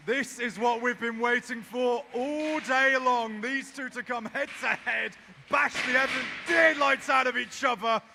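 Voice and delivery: shouted high-energy fight commentary; live play-by-play. Real, not acted. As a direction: yell the action as it happens.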